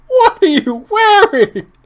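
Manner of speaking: high laughing, slow. Real, not acted. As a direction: laugh through the question. Laughing at outfit.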